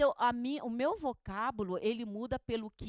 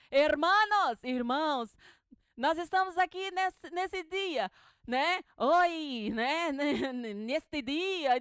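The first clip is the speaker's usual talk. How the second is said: higher, louder hammed-up Spanish-tinged preaching; mock Spanish preacher; 4 semitones higher, 4 dB louder, slower than usual. Spanish voice preaching alongside the radio preacher, loud. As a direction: imitate a preacher with a Spanish lilt. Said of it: "alguém falando em espanhol junto".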